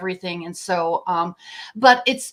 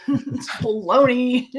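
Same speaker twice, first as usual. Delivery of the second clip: laughing scoff; 2 semitones higher, slower than usual. Real, not acted. Scoffing at official cover story.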